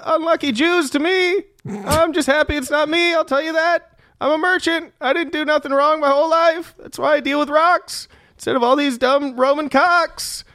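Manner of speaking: high-pitched, self-satisfied street trader; indifferent rock merchant. Acted. Rock merchant shrugs off the crucified men, high-pitched griping.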